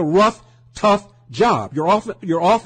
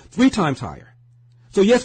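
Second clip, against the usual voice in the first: ordinary talk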